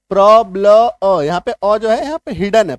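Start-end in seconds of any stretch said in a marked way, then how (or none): 0.1-1.2 प्रॉब्लम stretched slowly and loud, the hidden a heard as "ब्लम"; प्रॉब्लम drawn out loudly so the "अम" ending is clear; slow pronunciation demo. Shown as a demonstration. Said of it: "छुपा हुआ है". (प्रॉब्लम को धीरे और ज़ोर से, "ब्लम" पर ध्यान देकर)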